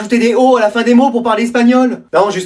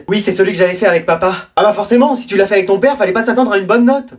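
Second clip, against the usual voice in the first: usual speaking voice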